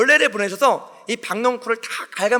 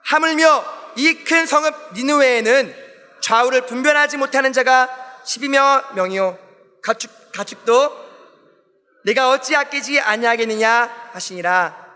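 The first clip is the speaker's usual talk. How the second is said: slow, measured verse recitation; scripture reading aloud; 2 semitones higher than usual. Acted. Preacher leads congregation reading the verse, slow recitation. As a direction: read it out slowly for a group to follow.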